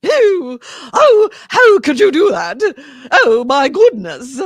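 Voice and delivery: scandalized grande dame, very high and loud; Margaret Dumont. Acted. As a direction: flutter in shock like an old-movie matron. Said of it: "impersonation that I can just nail".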